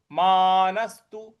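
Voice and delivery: usual speaking voice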